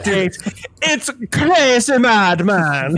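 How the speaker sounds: high, slow, stretched-out hype; excited tease. Real, not acted. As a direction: hype him up with a drawn-out high call. Teasing before the accent.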